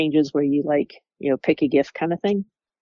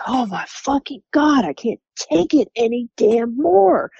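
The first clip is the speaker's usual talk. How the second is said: higher, drawn-out exasperated cry of being fed up; exasperated outburst; 8 semitones higher than usual. Retold or imitated. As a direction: let it burst out like she's at her limit. Coworker bursts out, not knowing narrator's there.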